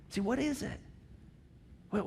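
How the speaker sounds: ordinary talk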